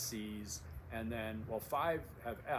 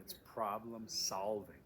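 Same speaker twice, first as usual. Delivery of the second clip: slow emphatic solving; stress on solving; slower than usual. Shown as a demonstration. Instructor driving home the key phrase, slowly. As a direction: land solving slowly and firmly. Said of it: "it's not self-enlightenment".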